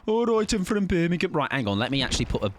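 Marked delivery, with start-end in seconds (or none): 0.0-1.3 high, exaggerated Brummie; mock Brummie twang; Birmingham accent. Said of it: "Birmingham, because it is so".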